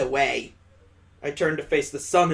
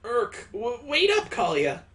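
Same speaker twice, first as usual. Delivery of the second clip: high, stumbling plea; flustered calling after; 7 semitones higher than usual. Acted. Straggler calling after Kalia to wait, flustered high voice. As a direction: stammer and call after someone running ahead. Character struggling to catch up.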